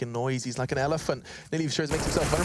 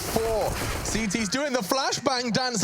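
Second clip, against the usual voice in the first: excited; 10 semitones higher, slower than usual. Real, not acted. Caster hype, CTs flashed.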